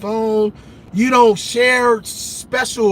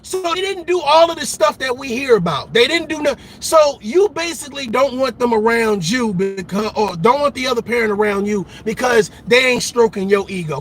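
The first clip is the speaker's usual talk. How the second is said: usual speaking voice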